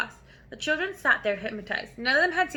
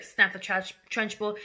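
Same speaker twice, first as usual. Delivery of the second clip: ordinary delivery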